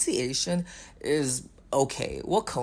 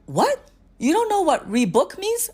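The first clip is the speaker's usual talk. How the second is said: higher, louder incredulous "what? You don't know"; 7 dB louder than usual. Retold or imitated. Agent stunned at a customer who can't rebook. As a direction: act stunned that he doesn't know. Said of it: "You would be like".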